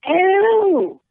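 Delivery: loud, high "ew"; disgusted squeal. Real, not acted. Reaction to podcast name.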